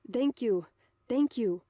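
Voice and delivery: grateful thanks in a hint of German accent, hurried; slight German accent. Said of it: "He's adopted a slight German accent for Osana".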